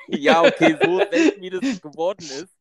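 laughing through the words; amused, giggling as you talk. Real, not acted. Joking about rare episodes.

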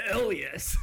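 mock cool reply. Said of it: "Evil villain laugh".

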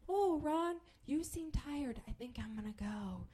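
high, tentative coaxing, fading softly; soft, careful excuse to leave; gentle waking. Retold or imitated. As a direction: ease away from someone dozing.

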